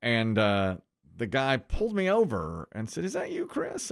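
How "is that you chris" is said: high, softer mock cop asking; a lightly voiced policeman, pitched up and hushed; retelling the officer's surprised question; imitating the cop.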